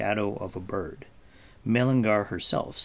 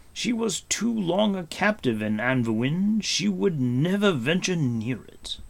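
higher, emphatic warning voice; Gwydion; 7 semitones higher, 4 dB louder than usual. Acted. Gwydion warns of dangerous lands.

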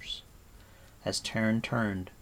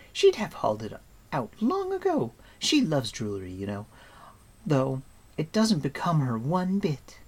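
put-on high, lively girl voice; Eilonwy's voice; 6 semitones higher, 4 dB louder than usual. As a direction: pert young girl, pitched high.

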